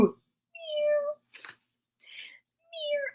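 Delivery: tiny high, soft mew; cat meow. Acted. Storyteller making cat sounds, high soft meow. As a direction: mew faintly like a kitten. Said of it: "I like making cat sounds".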